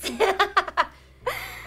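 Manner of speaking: high loud giggle; burst of laughter. Real, not acted. Laughing at her own craziness, high giggle. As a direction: laugh at yourself.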